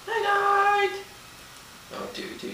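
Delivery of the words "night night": much higher, tiny puppy voice; squeaky tiny voice. Shown as a demonstration. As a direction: squeak it like a little toy dog. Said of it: "Say night, night".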